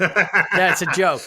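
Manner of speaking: laughing it out slowly and loudly. Real, not acted. Host laughing at his own pun to his co-host. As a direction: laugh through the punchline. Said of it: "That's a joke. You get it?".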